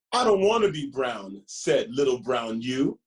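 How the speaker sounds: lively, sing-song picture book reading; reading to children. Acted. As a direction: read it like story time for little ones.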